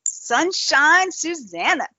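high, slow sing-song; playful high drawn-out call; sing-song nickname. Pretend. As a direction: croon the nickname brightly up high. Teasing nickname for co-host.

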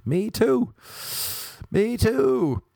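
high-pitched mock women's voice; put-on high voice, drawn-out endings; imagined keen women. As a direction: squeal it as an eager woman. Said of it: "And they're like".

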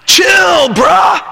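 "Chill, bruh" laut und gedehnt nachgeäfft; lazy teen voice. Retold or imitated. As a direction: den Teenager lauter und höher nachmachen. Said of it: "he'd say".

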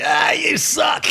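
high, slow drawn-out yell; mock heckler shout. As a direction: sneer it like a crank caller. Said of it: "they're like".

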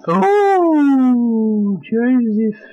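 long, high wailing "oh"; drawn-out call. Pretend. Host hailing his co-host Joseph, drawn-out high call. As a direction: hoot it out high and loud, sliding down. Performed intro, held high note.

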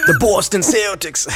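put-on Boston accent. Host shows the guest a region, mock Boston accent. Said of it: "you guys didn't specify region".

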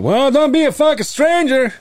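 put-on loud, high old-timer holler; Howie. Impression of old Howie calling out.

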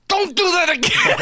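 high, strained angry squeal; angry outburst. Retold or imitated. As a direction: blurt it out high in helpless anger. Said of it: "I'm just angry".

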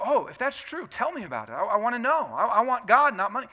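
high, loud, eager plea; mock eagerness. Preacher acting the Pharisees' imagined reply, eagerly pleading.

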